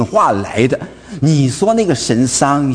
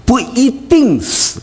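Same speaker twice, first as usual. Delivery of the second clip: slow, higher tempter's voice; the serpent tempting Eve, slow and sly; sly Satan; 7 semitones higher, much slower than usual. Retold or imitated. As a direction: draw it out like a cunning serpent. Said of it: "撒旦怎么说".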